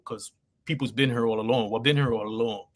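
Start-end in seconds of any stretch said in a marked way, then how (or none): none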